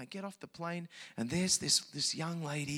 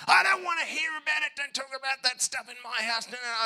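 higher, louder mock of a hostile host; 8 semitones higher, 5 dB louder than usual. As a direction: bristle like a host who wants no preaching. Quotes the atheist host.

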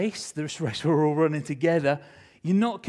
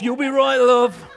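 high, loud pep talk to the runner; loud encouragement; 8 semitones higher, 10 dB louder than usual. Retold or imitated. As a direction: pump her up before the start. Coach dad encouraging daughter.

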